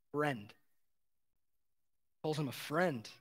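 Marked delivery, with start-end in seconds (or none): 0.1-0.5 soft, quiet and tender; gentle address. Retold or imitated. Master to grumbling laborer, tender. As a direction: say it kindly, softer.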